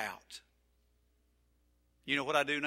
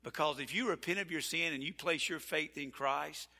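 ordinary delivery

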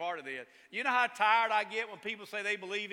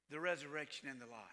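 dropped low and soft, hushed; soft reveal; 6 semitones lower, 8 dB quieter, faster than usual. Acted. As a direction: let it fall to a near hush. Sudden soft delivery after I am.

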